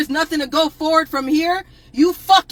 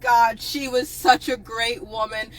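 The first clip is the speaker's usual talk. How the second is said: mock grieving fans; 5 semitones lower, slower than usual. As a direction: wail it like a fake mourner. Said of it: "everybody is".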